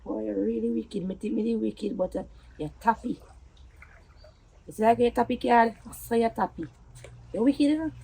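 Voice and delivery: very soft, low voice; subdued bitterness. Acted. Partner calling the other wicked, quietly and bitterly. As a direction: drop right down, quiet and low.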